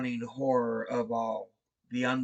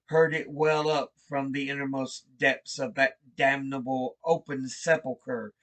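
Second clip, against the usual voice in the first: plain reading voice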